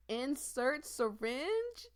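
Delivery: slow dread. Real, not acted. Reads game choice.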